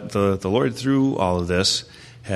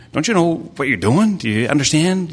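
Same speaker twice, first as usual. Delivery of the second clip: mock second-guessing; 8 semitones higher, 4 dB louder than usual. Mimics people second guessing God.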